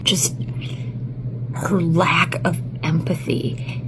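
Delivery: slow disgusted whisper. Real, not acted. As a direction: breathe the words out, appalled. Disgusted reaction to a video.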